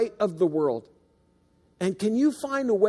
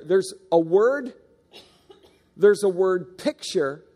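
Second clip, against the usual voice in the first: ordinary talk, nothing marked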